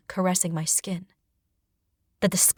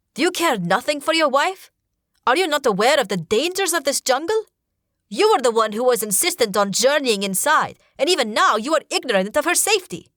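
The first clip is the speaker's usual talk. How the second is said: higher, louder furious rebuke; angry shout; 7 semitones higher, 5 dB louder than usual. Acted. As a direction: shout the accusation at him. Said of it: "Ruku shouts".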